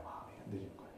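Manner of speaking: 흔들리는 breathed out in a whisper, voice dropped away. Shown as a demonstration. Pastor confides to the congregation his heart wavered, whispering. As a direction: repeat it as a hushed confession, 마음이 흔들리는 whispered. Repeats the confession as a hush.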